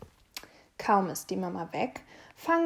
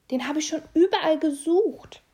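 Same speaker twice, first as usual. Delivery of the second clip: loud, high, delighted voice; happy relief; 7 semitones higher, 7 dB louder than usual. A narrator's reading. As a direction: glad to have the toy back.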